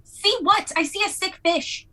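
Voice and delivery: high-pitched incredulous retort; exasperated arguing. Acted. Acted quarrel over the fish.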